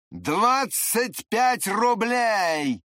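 high, very slow drawn-out announcement; triumphant proclamation. A narrator's reading. Drunk man brandishes snatched banknote.

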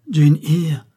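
astonished, high and forceful; overwhelmed exclamation. A narrator's reading. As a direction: cry out the beloved name in amazement. Overwhelmed man repeats her name.

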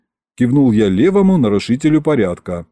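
ordinary delivery

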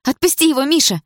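высокий, громкий женский крик; крикнуть высоко, останавливая драку. A narrator's reading. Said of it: "закричала Дана". (отчаянный крик)

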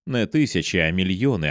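ordinary delivery

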